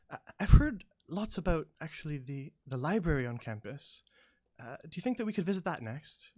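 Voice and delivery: eager gnomish woman. Acted. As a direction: pitch up into a bright-eyed small visitor. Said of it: "the gnomish woman says".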